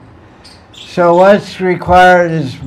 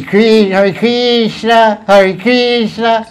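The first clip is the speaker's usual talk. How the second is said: slow, higher Italian "Hare Kreeshna"; Italian accent; 3 semitones higher, slower than usual. Retold or imitated. Showing how they chant in Italy, slow imitated Italian accent. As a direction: stretch "Krishna" Italian-style, pitched up. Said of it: "in Italy, they say Krishna".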